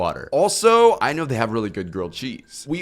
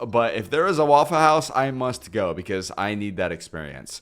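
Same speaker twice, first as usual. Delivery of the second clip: normal talking voice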